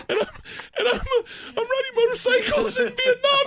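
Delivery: high, breaking into laughter; barely getting it out through laughter; laughing hard. Real, not acted. Laughing hard mid-story.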